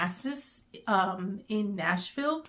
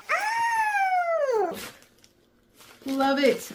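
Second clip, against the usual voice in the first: high excited yelp; delighted oh; 4 dB louder, much slower than usual. Real, not acted. Opening a book parcel, excited squeal.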